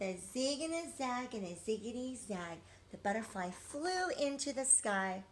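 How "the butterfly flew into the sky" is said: plain reading voice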